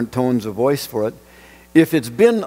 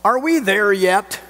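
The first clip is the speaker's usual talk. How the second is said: a playful called-out question, pitched up; mock bellow; 7 semitones higher than usual. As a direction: call it out like a bored kid on a trip. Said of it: "so I bellowed out".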